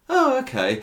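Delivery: high-pitched mock polite "oh, okay"; underwhelmed reaction. Viewer reacting to Stephen popping out of the taxi, mock underwhelmed. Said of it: "only so much excitement".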